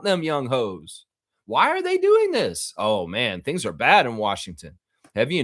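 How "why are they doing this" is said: incredulous. Real, not acted. Incredulous high-pitched question.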